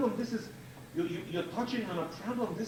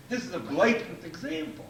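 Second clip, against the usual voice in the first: ordinary talk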